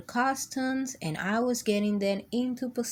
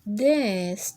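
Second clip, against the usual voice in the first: plain reading voice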